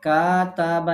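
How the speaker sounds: slow, stretched কাতাবা with long vowels; কাতাবা stretched slowly, as a teacher shows the error; wrong drawn-out way. Shown as a demonstration. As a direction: drag out কাতাবা to show the mistake. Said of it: "এমন না".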